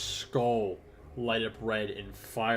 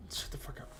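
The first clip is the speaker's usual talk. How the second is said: hushed snap; 11 dB quieter than usual. Acted. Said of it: "he goes".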